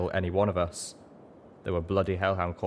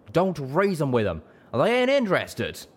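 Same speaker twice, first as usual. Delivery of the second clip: much higher, louder rough yell; Calamity yells at Velvet; 9 semitones higher, 5 dB louder than usual. Acted. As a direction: shout across the battle. Calamity yelling across the fight.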